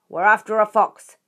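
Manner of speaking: loud bark back up; loud reply. A narrator's reading. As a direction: shout it up to the crowd. Bogus answering the crowd.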